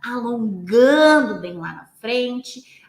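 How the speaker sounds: ALONGANDO stretched out long and loud; slow, lengthening. Shown as a demonstration. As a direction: draw the cue out slowly, like the stretch. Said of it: "a minha voz vai mudar o jeito". (voz lenta e alongada)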